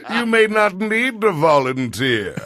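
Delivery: lightly bubbly fat character voice; pompous fat fellow, lightly. Shown as a demonstration. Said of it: "bubbly fat voice".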